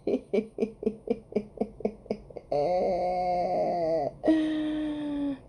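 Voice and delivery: high, helpless tittering; long giggling laugh. Real, not acted. Reader reminded of another reader, helpless giggling. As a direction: laugh till it trails into a squeal. Tickled by the card's likeness.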